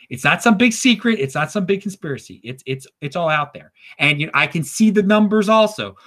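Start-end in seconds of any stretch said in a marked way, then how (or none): none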